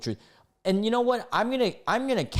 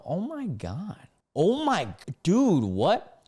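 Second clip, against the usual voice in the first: stunned disbelief; 8 semitones higher, 3 dB louder, slower than usual. Real, not acted. Shocked reading a racist post.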